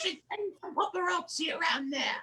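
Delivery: very high put-on British queen; Queen Elizabeth. The Queen phones the paparazzi, high mock-regal voice. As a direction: squawk it as a scheming old royal. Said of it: "she's gonna say".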